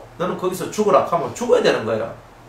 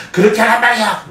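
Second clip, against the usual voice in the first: higher and louder mock yell, with a laugh; yelling parent; 6 semitones higher, 6 dB louder than usual. A parent losing their temper at a child, mock yell. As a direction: escalate the scolding to a shout. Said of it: "어디까지 목소리를 높여야 돼요".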